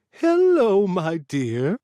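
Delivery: put-on high, sing-song old woman greeting; old lady voice. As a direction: croon it like a sweet old lady. Narrator voicing the old lady.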